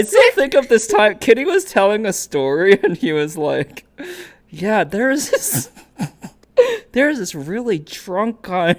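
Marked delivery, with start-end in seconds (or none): 4.5-8.8 laughing. Retold or imitated. Quoting Kitty through laughter.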